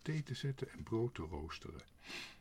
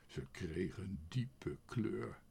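ordinary delivery, nothing marked

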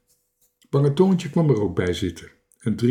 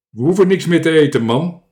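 loud, quick, raised voice; speaking from up high; 4 semitones higher, 8 dB louder, faster than usual. A narrator's reading. Little captain to the man from atop giraffe, calling. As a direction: call it down briskly. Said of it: "sprak hoog vanaf zijn giraf".

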